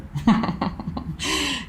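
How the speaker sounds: short high laugh at herself; amused burst of laughter; real laugh. Real, not acted. Laughing at own joke.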